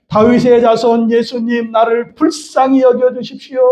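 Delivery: slow, high congregational cry. Shown as a demonstration. Preacher leads congregation calling on Jesus like Bartimaeus, crying out. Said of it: "바디메오처럼 외쳐봅시다".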